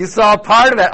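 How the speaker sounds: normal talking voice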